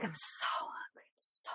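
whispered, weak and starving. Retold or imitated. Brian in the back seat, whispering weakly that he's starving. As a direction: whimper it like you're fading from hunger.

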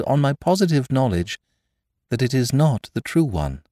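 high-pitched, respectful address; measured courtesy in a lifted voice; marked respect. A narrator's reading. Father Brown correcting the curate's theory, with marked respect. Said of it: "he said with marked respect".

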